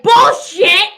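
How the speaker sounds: high, loud shout of disbelief; Cecilia calling bullshit. Retold or imitated. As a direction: bark it out as a loud call-out. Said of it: "Cecilia's like".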